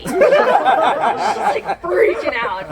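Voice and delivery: usual speaking voice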